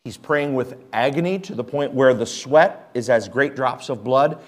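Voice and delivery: normal talking voice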